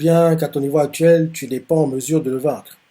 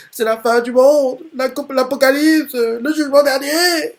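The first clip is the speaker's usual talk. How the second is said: high, loud tearful wail; hammed-up sobbing panic, much higher and louder; crying out in despair; 12 semitones higher, 5 dB louder than usual. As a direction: wail like a kid sure he's about to die. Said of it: "il est en train de crier comme ça, les larmes aux yeux".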